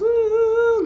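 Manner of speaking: very high siren ooh; siren wail. Retold or imitated. Describing sirens coming for the house, high siren wail.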